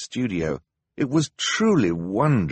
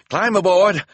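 high, loud urging call; 11 semitones higher, 7 dB louder than usual. A narrator's reading. Hector calling down to the children, urgent shout. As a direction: shout the invitation down to them.

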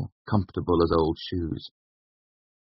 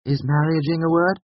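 troubled; 11 semitones higher, 3 dB louder than usual. A narrator's reading. Bast troubled question.

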